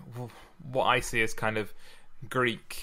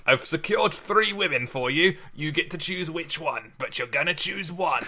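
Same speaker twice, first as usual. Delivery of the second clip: high-pitched, insistent matchmaking uncle; Uncle Billy; 6 semitones higher than usual. Acted. Uncle pushing three women on his lad, pushy urging. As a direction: press the lad to pick, pitched well up. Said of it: "Listen to your Uncle Billy".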